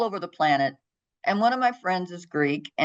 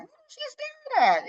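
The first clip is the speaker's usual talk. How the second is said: pitched way up, baffled; a high, bewildered question; puzzled inner voice; faster than usual. Retold or imitated. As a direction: wonder aloud much higher. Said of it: "And I'm like".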